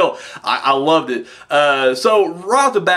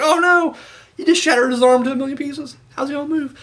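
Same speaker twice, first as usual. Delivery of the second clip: high, alarmed exclamation; mock alarm; 9 semitones higher than usual. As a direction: act horrified at the arm break. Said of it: "I was like".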